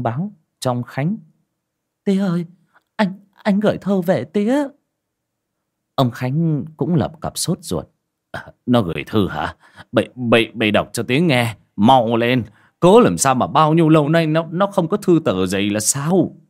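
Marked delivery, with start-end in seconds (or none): 2.0-4.7 excited daughter. Acted. Nhàn runs in with letter. 8.3-16.4 lightly voiced old father, louder and hurried; a father begging to hear his son's letter; eager old father. Old father urging daughter to read the letter, hurried and louder.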